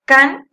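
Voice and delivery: "Can" punched loud; stressed syllable. Shown as a demonstration. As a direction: hit "Can" as the strong syllable. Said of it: "es su sílaba tónica".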